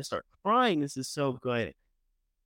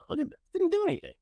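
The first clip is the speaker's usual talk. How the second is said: mock outrage; 13 semitones higher, much faster than usual. Real, not acted. Mock exasperated outburst.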